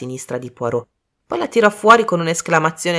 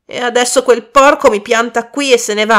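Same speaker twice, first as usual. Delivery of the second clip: louder, higher, indignant tirade; angry outburst; 5 semitones higher, 6 dB louder than usual. A narrator's reading. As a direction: rant furiously about the man who dumped you. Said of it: "Poi cambiò tono bruscamente". (sfogo rabbioso e indignato)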